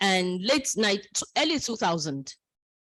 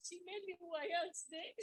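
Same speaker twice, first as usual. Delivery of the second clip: voice breaking; 9 semitones higher, 19 dB quieter than usual. Real, not acted.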